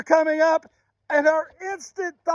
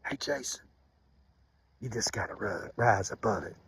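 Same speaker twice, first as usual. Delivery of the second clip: much lower and softer, almost hushed; soft recalled mother's voice; 13 dB quieter than usual. Retold or imitated. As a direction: say it quietly like a distant memory. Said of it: "would remind me".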